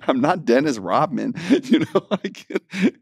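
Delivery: ordinary talk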